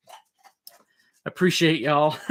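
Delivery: slow drawn-out Southern twang; Southern accent. Pretend. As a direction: stretch it out in a mock Southern accent. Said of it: "she ain't got that accent though".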